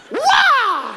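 high loud karate kiai; sharp high karate yell; karate shout. Shown as a demonstration. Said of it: "And then you go".

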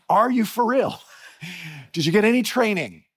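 retold disbelief, high and loud; acted-out incredulous questioning. Retold disbelief at a clueless guide.

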